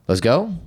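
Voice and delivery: "Let's go" lifted into a questioning rise; imitated "Let's go?" with an asking tune; statement as question. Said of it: "you say it with a question phrase".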